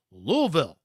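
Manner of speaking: Louisville as two syllables, LOO-vill; Louisville squeezed into "LOO-vill"; careful local pronunciation. Shown as a demonstration. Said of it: "now I have to say this right".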